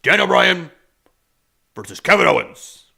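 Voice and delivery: hammed-up match announcer, loud, with big pauses; McMahon. Podcaster imitates the boss announcing the WrestleMania match, showy proclamation. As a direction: boom out each piece like a ring announcement. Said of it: "McMahon will come out and be like".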